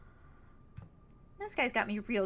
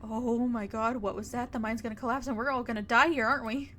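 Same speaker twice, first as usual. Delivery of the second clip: louder, rising alarm; panicked; 6 dB louder than usual. Acted. Friend hearing a rumble in the mine, panicking. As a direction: spiral into panic over a noise.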